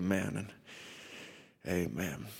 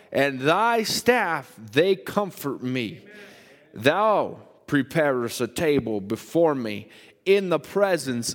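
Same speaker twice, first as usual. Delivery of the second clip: slow, emphatic psalm reading building higher and louder; solemn scripture reading; 4 semitones higher, 6 dB louder, much slower than usual. Acted. Pastor reading Psalm twenty-three aloud to congregation, slow and building. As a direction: proclaim each line slowly, swelling toward the valley verses.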